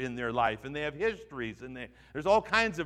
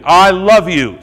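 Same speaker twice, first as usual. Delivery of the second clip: loud, higher call-and-response line; call to repeat; 5 semitones higher, 15 dB louder, slower than usual. Shown as a demonstration. Preacher leading the congregation in a loud chorus, higher. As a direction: shout it out for the crowd to echo. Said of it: "Say it with me".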